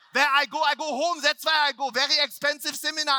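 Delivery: put-on high, loud, heavy German accent; flustered shouting in thick German-accented broken English; agitated German-English. Upset speaker to lead trainer, agitated German-accented English. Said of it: "like Jinglish".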